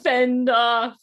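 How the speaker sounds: loud, high talk through laughter; laughing. Real, not acted. Host cracking up over her junk food meme, laughing. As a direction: laugh through the words, voice pushed up. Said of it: "and I'm laughing".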